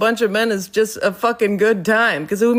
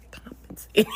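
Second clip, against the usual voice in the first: whispered correction; 5 dB quieter than usual. Retold or imitated. Boss whispering the correct word to the vice president.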